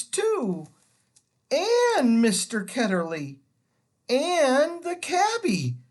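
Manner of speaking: a lightly voiced girl calling out in surprise; Polly exclaiming on seeing the horse; cried out. A narrator's reading. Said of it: "Polly cried out".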